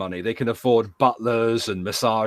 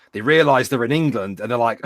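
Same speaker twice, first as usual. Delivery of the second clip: usual speaking voice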